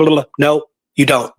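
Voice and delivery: ordinary delivery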